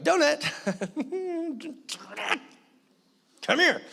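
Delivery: high, sing-song call to the dog. Retold or imitated. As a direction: coax the dog over in a high, laughing voice. Calling the dog by name.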